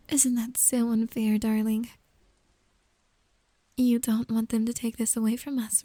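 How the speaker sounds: ordinary talk, nothing marked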